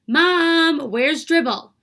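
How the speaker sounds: loud worried call to mom; hollered. A narrator's reading. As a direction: yell for mom in a panic.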